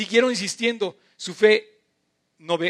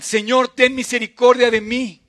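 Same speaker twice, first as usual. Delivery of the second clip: higher, louder plea, like a cry of pain; desperate cry for mercy; 5 semitones higher, 8 dB louder than usual. Shown as a demonstration. Preacher rereads the blind men's plea to his congregation, crying out loud. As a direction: cry out to the Lord like a woman in labour. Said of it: "equivale al grito de dolor de la mujer cuando está dando a luz".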